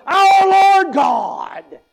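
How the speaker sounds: booming drawn-out pulpit voice, high and very slow; mock a preacher who turns possessed in the pulpit; hammed-up preacher boom. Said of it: "a preacher that has a preacher voice".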